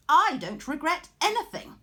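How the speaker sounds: loud, high, cocky declaration; proud defiance. A narrator's reading. Arachne defying the goddess, loud and cocky. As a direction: fling it back proudly.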